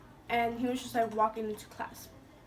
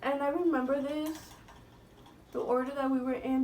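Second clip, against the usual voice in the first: ordinary talk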